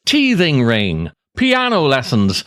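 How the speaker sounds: ordinary talk, nothing marked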